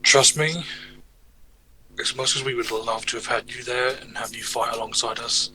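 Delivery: ordinary delivery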